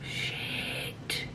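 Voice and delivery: drawn-out, voiceless whispered swear; whispered shock. Retold or imitated. Retelling the first bit of violence, shocked whisper. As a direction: breathe it out as a stunned retold reaction. Reaction to on-screen violence retold.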